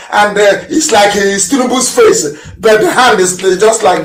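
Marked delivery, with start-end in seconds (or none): none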